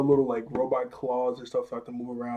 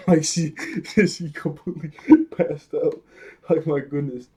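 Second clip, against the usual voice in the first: louder, laughing through the words; real laughter; 2 semitones higher, 4 dB louder than usual. Real, not acted. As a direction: crack up mid-sentence. Laughs at the character fainting.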